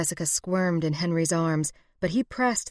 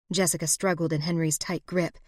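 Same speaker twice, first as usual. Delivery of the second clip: plain reading voice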